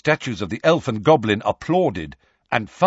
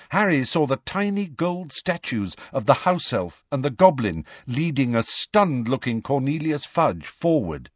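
ordinary delivery

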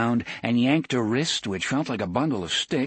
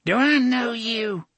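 put-on high, louder, peering old man; 14 semitones higher, 5 dB louder than usual. A narrator's reading. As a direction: lean in and question him. Old man peers closely.

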